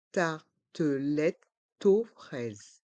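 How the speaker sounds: slow "tartelette, taux, fraise" in separate beats; learner-paced, split "tartelette, taux, fraise"; syllable by syllable. Shown as a demonstration. Teacher breaks word into syllables.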